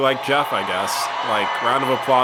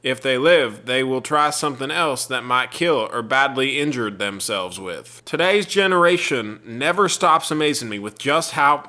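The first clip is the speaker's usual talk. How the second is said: lightly hammed Southern accent, louder on the insults; 3 dB louder than usual. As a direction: keep up the mock Southern commenter voice. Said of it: "I'm gonna be reading it in a southern accent".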